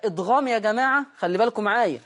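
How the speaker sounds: ordinary talk, nothing marked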